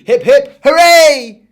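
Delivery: three cheers at full lungs; a boy cheering for the baker. Acted.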